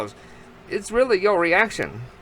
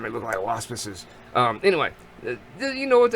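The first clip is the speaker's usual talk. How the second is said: usual speaking voice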